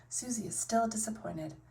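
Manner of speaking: plain reading voice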